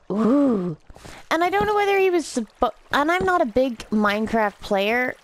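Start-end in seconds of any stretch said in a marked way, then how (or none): none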